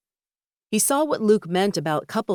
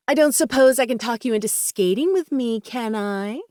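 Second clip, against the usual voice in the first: higher, swooping coy wheedle; flirtatious Sky, coaxing; 6 semitones higher than usual. Acted. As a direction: coax flirtatiously, ending on a rising question. Narrator voices flirtatious Sky.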